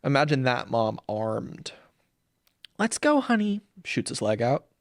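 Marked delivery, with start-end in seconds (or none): 2.8-3.6 high, tight-lipped mom voice; sweet-stern mom. Pretend. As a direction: chirp it sweetly but firmly.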